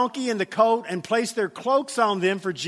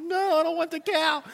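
put-on little girl voice; 7 semitones higher than usual. Daughter voiced in story.

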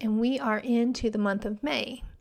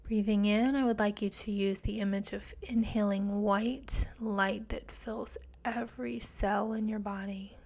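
guided meditation; 4 dB quieter than usual. Acted. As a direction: guide them gently into calm.

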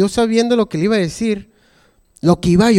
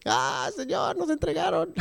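whiny complaint; 9 semitones higher, 11 dB quieter than usual. Retold or imitated. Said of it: "estado mental".

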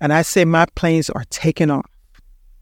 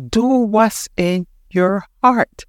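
drawn out word by word; slow emphatic repeat; slow, deliberate urging; 3 semitones higher, slower than usual. Real, not acted. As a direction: press it home slowly, pausing between words.

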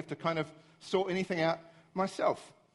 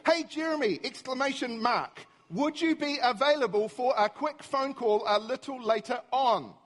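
higher, over-enunciated dictation to a phone; strained, emphatic re-dictation, pitched up; exasperated dictation; 5 semitones higher than usual. Retold or imitated. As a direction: spell it out to a stupid machine, losing patience. Frustrated repeat dictation to Siri.

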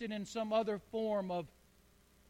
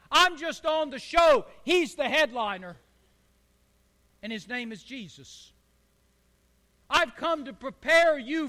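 loud, building preacher's proclamation; preaching as John; 4 semitones higher, 9 dB louder than usual. Acted. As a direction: preach it like the Baptist crying out. Preaching John's message at full force.

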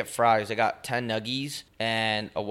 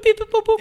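high-pitched lock beeps; high beeping imitation; 8 dB louder, faster than usual. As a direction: squeak out the beeps like a keypad. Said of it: "Just go boop".

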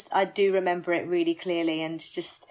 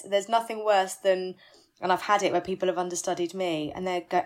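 ordinary talk

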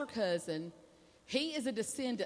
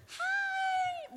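very high, shouted hello; high-pitched greeting. Retold or imitated. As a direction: call out in a piercingly high voice. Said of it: "real high pitch".

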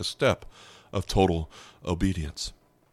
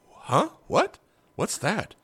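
baffled disbelief; 10 semitones higher, slower than usual. Retold or imitated. Elijah baffled by God's command.

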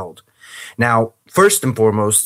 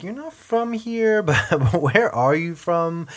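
much higher, slow puzzled question; imitating confused locals; 7 semitones higher, slower than usual. Northern Irish locals quiz the American, high slow puzzled voice. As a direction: draw it out like a baffled local. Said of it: "They're like".

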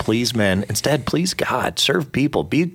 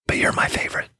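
whispering a secret. Retold or imitated. Dad secretly telling daughter.

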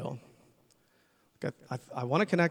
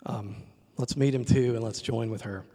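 ordinary talk, nothing marked

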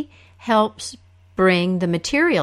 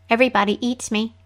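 quick small root voice; a brisk little voice from the creek; trickster tale voices; 3 semitones higher, much faster than usual. Acted. Root's voice again.

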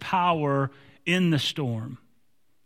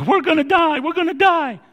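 high, loud frantic cry; panicked screaming; 10 semitones higher, 5 dB louder than usual. Acted. Twelve disciples screaming at sleeping Jesus, panicked. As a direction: scream it like a doomed sailor. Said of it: "Twelve guys screaming at you".

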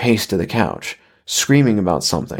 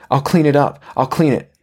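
higher, anxiously placating voice; anxious apology; 5 semitones higher than usual. Acted. Wife hurrying to placate her angry husband, anxious apology. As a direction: raise the pitch and plead lightly.

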